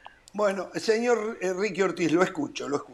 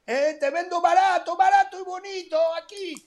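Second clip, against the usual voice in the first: high sing-song street vendor pitch; singsong market price calls; 10 semitones higher than usual. Acted. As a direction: hawk it like a Turkish bazaar seller. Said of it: "Parece un mercado turco".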